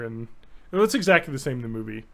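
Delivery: usual speaking voice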